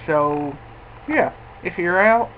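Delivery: talking around pipe stem; speaking with clenched teeth; pipe in mouth. Real, not acted. Seen 1: Pipe stem held in his mouth throughout the labelled words.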